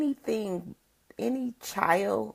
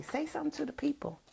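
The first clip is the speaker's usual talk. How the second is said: put-on higher and fast, gently urging a child; a grandma prompting her grandson, higher and faster; coaxing a grandson; 8 semitones higher, 8 dB quieter, much faster than usual. Shown as a demonstration. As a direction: nudge the little one to speak up, quick and high. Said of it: "I do my grandson like that".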